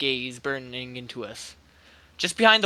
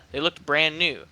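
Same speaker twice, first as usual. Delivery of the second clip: ordinary delivery, nothing marked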